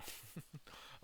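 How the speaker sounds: unvoiced high wheezing laugh; a quick silent-ish giggle; soft airy laugh at his own joke; breathy high giggle. Real, not acted.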